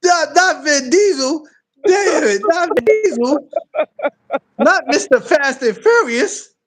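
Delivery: high, slow, laughing whine; real laughter in the voice; laughing protest. Real, not acted.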